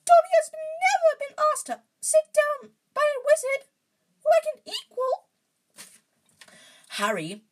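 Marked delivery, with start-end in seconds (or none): 0.1-5.3 tearful, very high loud elf cry; choked. A narrator's reading. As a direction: choke it out in a wailing falsetto. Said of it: "choked the elf".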